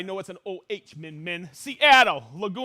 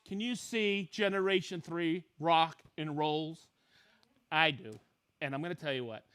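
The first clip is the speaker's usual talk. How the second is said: usual speaking voice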